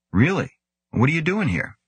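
surprised. A narrator's reading. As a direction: caught off guard, voice jumps up. Grant surprised at biophysicist here.

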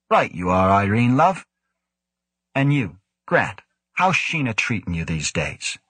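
lightly voiced cocky joker; cheeky Devlin teasing. A narrator's reading. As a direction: needle him with a grin you can hear. Devlin's jaunty reply.

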